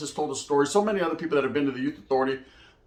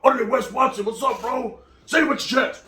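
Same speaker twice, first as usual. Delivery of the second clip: calling out; 7 semitones higher than usual. Retold or imitated. Inmate calling out to speaker.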